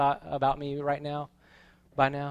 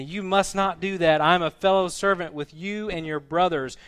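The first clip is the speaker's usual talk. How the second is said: raised, emphatic scripture quote, building louder; angel's command; 5 semitones higher, 8 dB louder than usual. Acted. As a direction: build to a forceful closing command. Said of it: "But he said to me".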